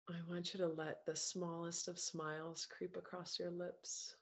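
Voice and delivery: guided meditation. Acted. Coach guiding a group through breaths and self-love, calm meditation voice.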